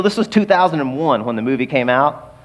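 ordinary delivery

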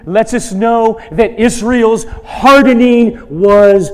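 forceful preaching. Acted. Pastor driving home God's sovereignty to the congregation, loud preaching. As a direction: proclaim God's control with rising force.

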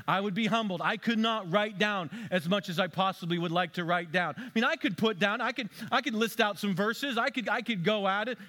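normal talking voice

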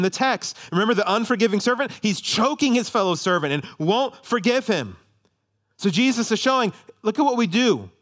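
high, loud pulpit proclamation; forceful preaching. Real, not acted. Preacher rebuking those who put themselves above God, forceful preaching.

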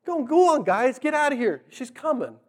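high, hushed urging; urgent shooing. Retold or imitated. Jacob clearing out the shepherds before Rachel arrives, urgent. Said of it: "he wants to be alone".

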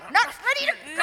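high, loud, stubborn outburst; upset refusal. Acted. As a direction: dig in your heels and yell it. Refusing to leave, frightened.

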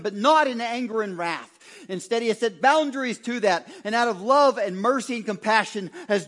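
loud, ringing preacher at full force; full-force preaching. Acted. Preacher to congregation on God's mercy, loud triumphant preaching. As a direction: preach it out triumphant, driving every line.

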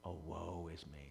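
low, hushed confession of woe; quiet lament. Acted. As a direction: lament softly, as if humbled before God. Quiet personal lament after confession.

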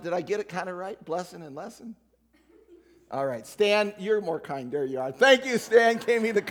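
normal talking voice

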